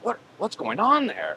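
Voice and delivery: high, slow questioning voice; high-pitched retold puzzlement; imitating puzzled caller. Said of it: "the guy's like".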